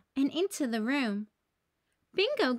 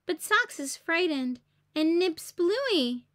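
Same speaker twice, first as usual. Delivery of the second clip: plain reading voice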